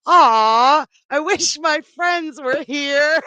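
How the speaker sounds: high, loud, drawn-out wishing; ditzy blonde joke voice. Acted. Lonely blonde making a wish, high mock-wistful voice. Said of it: "The blonde said".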